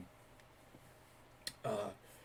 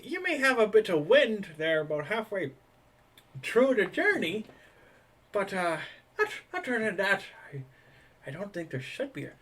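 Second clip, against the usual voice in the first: put-on high, chatty old dock hand; high old sailor voice; 9 semitones higher than usual. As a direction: raise the pitch, old sailor rambling. GM voices same NPC.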